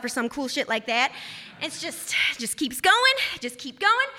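normal talking voice

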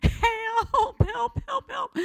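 high frantic cries for help; mock panicked scream. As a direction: squeal it like you're trapped and terrified.

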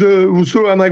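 normal talking voice

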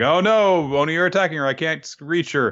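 high-pitched retold cry of alarm; mock panic. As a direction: wail it in hammed-up dismay, easing off. Hero panics as friend is attacked.

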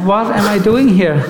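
usual speaking voice

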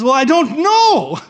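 high, loud, drawn-out mock protest; exasperated protest. Thief answering the angel's challenge, loud exasperated protest.

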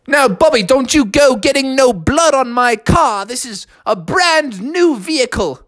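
high, scolding dad voice; dad protecting his new car; stern dad. Acted. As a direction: warn him off the seats.

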